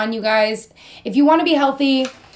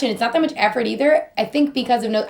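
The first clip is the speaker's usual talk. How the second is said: ordinary talk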